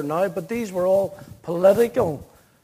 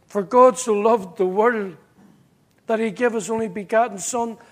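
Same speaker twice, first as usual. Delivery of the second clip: scripture read aloud; 5 dB louder than usual. Shown as a demonstration. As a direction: proclaim it like reading from the pulpit. Reads scripture from the flyleaf.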